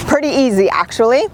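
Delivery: ordinary talk, nothing marked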